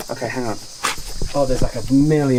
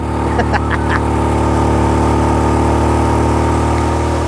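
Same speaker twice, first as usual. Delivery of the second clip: breathless laugh; 3 semitones lower, 5 dB louder than usual. Real, not acted. Scooter struggling up the hill.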